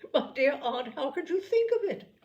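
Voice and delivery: laughing, rising high in disbelief; amused disbelief. Acted. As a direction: laugh off the absurd idea. Elizabeth laughs at aunt's idea.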